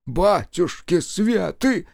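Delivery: high, slow horrified exclamation; gasping old servant. A narrator's reading. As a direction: throw up your hands and gasp it. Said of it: "всплеснул руками слуга".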